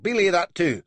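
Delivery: loud, high "belay" dropping; belay stressed, falling; frustrated countermand. A narrator's reading. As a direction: call it off again in frustration. Countermands again.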